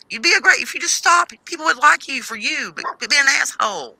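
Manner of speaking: high pleading, slowing down; exasperated scolding. Retold or imitated. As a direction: plead with them, then scold. Scolding someone to quit it.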